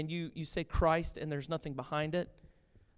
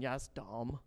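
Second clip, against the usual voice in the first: muttered agreement; 5 semitones lower, 8 dB quieter than usual. Retold or imitated. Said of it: "Everybody's going".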